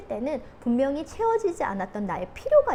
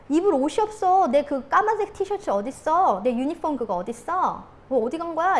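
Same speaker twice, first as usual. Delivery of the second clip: mild mock husband's grumbling, raised voice; negative complaint; 5 semitones higher, 4 dB louder than usual. Husband to wife over missing clothes, mock complaining. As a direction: imitate a husband demanding his things. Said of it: "부정적인 얘기를 했어요".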